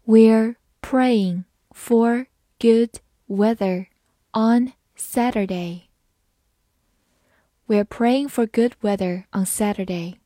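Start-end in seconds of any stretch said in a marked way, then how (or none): 0.0-5.8 very slow, word by word; slow read-through. Shown as a demonstration. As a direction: read it slowly with a gap after each word. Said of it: "好，慢读一遍".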